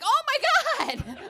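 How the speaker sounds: high, slow, astonished gasp of wonder; amazed kid. Retold or imitated. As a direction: kid blown away by toast.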